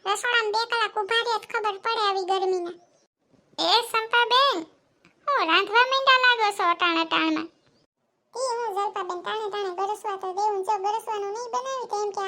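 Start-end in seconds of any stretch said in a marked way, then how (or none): 3.6-7.5 raised voice calling a neighbour at her door. Acted. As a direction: call her name from outside. (પડોશણને બૂમ પાડીને બોલાવવું)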